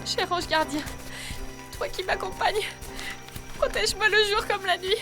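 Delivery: high, soft, fearful prayer; pleading prayer. Acted. Woman alone prays to her guardian angel, pleading. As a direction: pray softly for protection, voice pitched high.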